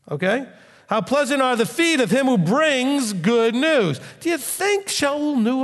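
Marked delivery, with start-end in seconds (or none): 0.9-4.0 preaching scripture. Acted. Repeats verse, raised and slowed.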